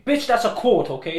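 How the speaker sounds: loud and high, mock outraged; mock angry. Real, not acted. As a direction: snap back in playful outrage. Friend mocks his quote.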